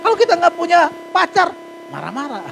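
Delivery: high, loud preaching push. Acted. Pastor urging the congregation to pray, high and loud. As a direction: preach it to the back row.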